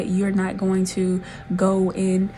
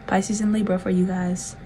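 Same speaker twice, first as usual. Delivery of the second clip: ordinary delivery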